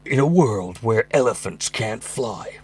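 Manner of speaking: slow, soft trailer voice with a long pause; movie trailer voiceover. Retold or imitated. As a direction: intone it like an epic eighties trailer. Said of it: "Doesn't matter what it is".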